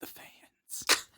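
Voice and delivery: soft comic whisper. Pretend. Blaming the fans on air, joking whisper. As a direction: whisper it like a guilty aside.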